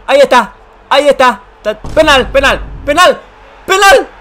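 penalty shout. Real, not acted. Gamer yelling at the referee, building shout. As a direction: demand the penalty.

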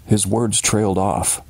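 usual speaking voice

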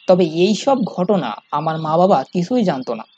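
ordinary delivery

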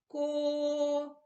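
Ко with the о held long and level; stretched vowel. Shown as a demonstration. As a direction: hold the о in Ко. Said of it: "Чуємо звук О".

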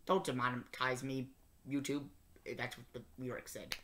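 muttered under the breath; low, soft plea to the camera; quiet aside. Real, not acted.

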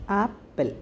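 "apple" pulled apart into two beats; split into syllables. Shown as a demonstration. Teacher dividing a word into two for pupils, "apple" split in syllables. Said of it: "it is divided into two".

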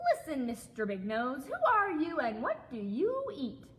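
loud, high-swooping storybook shout; brash frog demanding answers. A narrator's reading. Frog questions big-nosed stranger, shouted. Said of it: "shouted the wide-mouthed frog".

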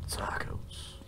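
breathy whispered single word; whispering. Acted. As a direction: whisper it to yourself. Blaming the tacos after puking.

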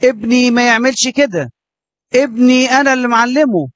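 high, indignant protest from an offended father; hammed-up outraged defence of his son; mock angry father. Father defends his son to the priest, indignant and high. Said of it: "تلاقي أبو فلان زعق".

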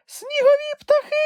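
very high, squeaky little creature voice; a tiny animal piping up in excitement; Kulka exclaiming. A narrator's reading. Kulka tells Grampus they are almost there, squeaky excited cry. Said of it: "захоплено крикнула Кулька".

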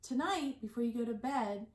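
ordinary delivery

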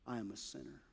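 a beaten man barely voicing his confession; hushed, humble prayer for mercy; humble sinner's plea. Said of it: "he beat his chest and said".

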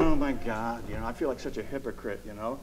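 soft, fast self-reproach; despairing mutter. Retold or imitated. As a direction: quietly beat yourself up. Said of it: "you're going".

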